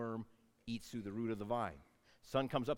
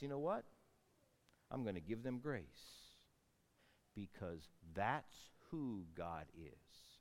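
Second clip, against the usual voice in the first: God's gentle voice; 5 semitones lower, 7 dB quieter, slower than usual. Acted. God voiced deciding grace.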